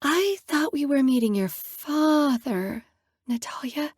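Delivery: breathless. Acted. Stunned girl meeting the handsome father, breathless whisper. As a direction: lose your breath at the sight of him. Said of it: "I say breathlessly".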